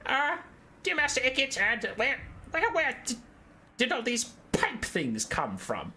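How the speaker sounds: high, timid squeaky underling; high nervous ratman voice; nervous rat soldier. Acted. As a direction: ask it like a jittery minion afraid of his boss. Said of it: "trusty Stormvermin compatriot goes".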